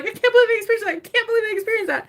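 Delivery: high, rushing retold amazement; high excited repeat of disbelief; amazed disbelief. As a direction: replay your own awe, fast. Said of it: "And I'm like".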